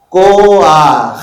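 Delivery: loud, drawn-out "Koach", a before the guttural ch; furtive patah demo. Shown as a demonstration. Teacher models for the class, loud "Koach" with a before ch. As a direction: model "Koach" firmly, ending on a throaty ch. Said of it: "tu le prononces d'abord et puis le chet après".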